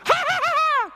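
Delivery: high, gleeful jeering laugh; a high mocking laugh. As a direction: laugh at him with spite. Son laughs at drunk father.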